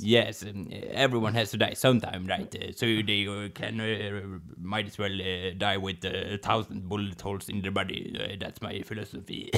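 low, soft, hesitant musing; Godfather figure, philosophical. Acted. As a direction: muse quietly as the mafia boss. Answers as the Godfather.

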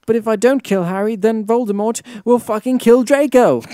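high, loud, distraught wail of words; hammed-up tearful outburst. A narrator's reading. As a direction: plead it melodramatically, close to tears. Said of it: "I burst into tears".

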